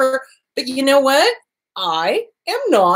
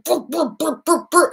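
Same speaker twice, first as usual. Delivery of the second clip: mock engine-like brr sounds, higher pitched; imitated grumbling brr; 6 semitones higher than usual. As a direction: sputter out an angry brr like a broken machine. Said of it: "and do".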